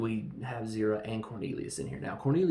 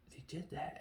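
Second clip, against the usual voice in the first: hushed stunned whisper; whispered awe; 6 semitones higher, 9 dB quieter than usual. Retold or imitated. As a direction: breathe it out in disbelief. Zira stunned reading the pad.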